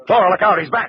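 loud, high cry of alarm; urgent warning shout. Acted. Detective warns Flora the gunman is back, urgent shout.

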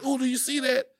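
high-pitched imitation of a startled partner; mock-startled high voice; startled girlfriend. Retelling girlfriend's startled reaction again.

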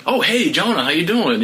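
lightly voiced GM almost bumped into; imitating the GM. GM runs into the pitcher in the hallway, mock cheery greeting. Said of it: "he's like".

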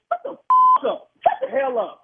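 very high, loud yell; furious yell. Real, not acted. Husband cutting off friend on phone, loud high fury. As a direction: scream it at him in rage.

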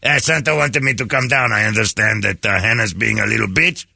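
elf reporting in a put-on French accent; mock-official complaint with a French accent. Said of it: "French accent".

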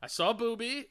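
usual speaking voice

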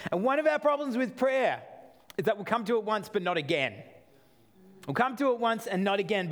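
ordinary talk

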